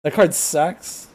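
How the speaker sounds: high, slow disbelieving echo; can't believe what he heard; incredulous. Real, not acted.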